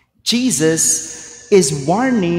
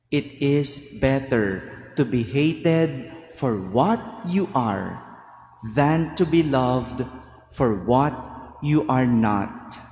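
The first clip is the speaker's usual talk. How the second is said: "It is better to be hated" said again, softer and lower; softer repeat; 6 semitones lower, 6 dB quieter than usual. Shown as a demonstration. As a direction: repeat "for what you are not" quietly and low. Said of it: "repeated for emphasis".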